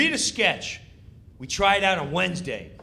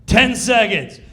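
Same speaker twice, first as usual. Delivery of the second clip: loud call; countdown call; 5 semitones higher, 10 dB louder, slower than usual. Retold or imitated. Comic imitates stage manager countdown, loud. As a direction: shout the countdown.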